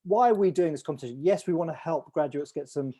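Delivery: normal talking voice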